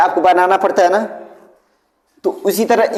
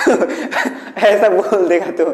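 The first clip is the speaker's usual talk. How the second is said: laughing while explaining. Real, not acted.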